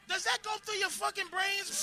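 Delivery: ordinary talk, nothing marked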